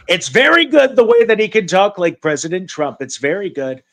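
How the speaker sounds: selling the Trump voice to Ray in the interview; Trump impression. Lightly done Trump impression. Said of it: "I can do President Trump".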